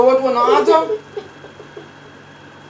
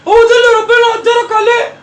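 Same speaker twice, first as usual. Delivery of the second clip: mocking shout; 12 semitones higher, 6 dB louder than usual. Grandma mocks boy's plan.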